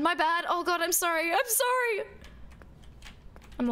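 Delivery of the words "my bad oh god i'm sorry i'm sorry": flustered apology. Real, not acted. Embarrassed apology, high.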